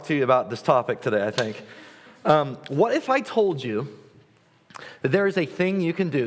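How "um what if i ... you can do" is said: infomercial pitch. Shown as a demonstration. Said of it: "This is gonna sound like an infomercial".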